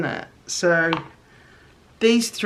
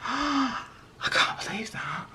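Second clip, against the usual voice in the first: a gasp, then a slow high whisper; awed whisper; 7 semitones higher, 8 dB quieter, slower than usual. Real, not acted. Artist uncovering her best crayon piece, slow awed whisper. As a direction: whisper it like a secret marvel.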